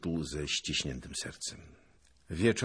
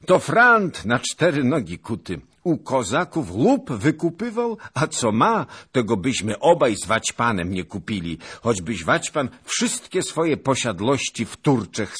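loud, high, emphatic outburst; high-pitched, boisterous protest; animated exclamation; 8 semitones higher, 10 dB louder than usual. A narrator's reading. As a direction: exclaim it all at full voice. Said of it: "zawołał pan Skrzytuski".

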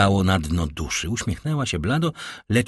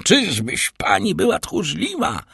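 high, slow, angry taunt; rising anger; 8 semitones higher than usual. A narrator's reading. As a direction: throw the question out with mounting fury. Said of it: "ciągnął Villefort z rosnącym gniewem". (z rosnącym gniewem)

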